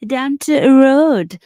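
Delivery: loud, drawn-out Yorkshire accent; Yorkshire twang. Shown as a demonstration. Streamer showing viewers the twang picked up since moving, broad Yorkshire accent. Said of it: "a Yorkshire twang going on".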